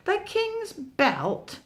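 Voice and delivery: high, slow wondering question; high amazed tiger. Acted. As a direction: marvel at the idea.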